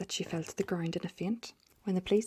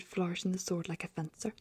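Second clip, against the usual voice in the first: ordinary delivery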